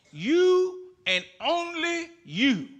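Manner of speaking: YOU hit high and slow, twice; emphatic you. Acted. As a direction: lean hard on both YOU's, slowly. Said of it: "it's emphatic".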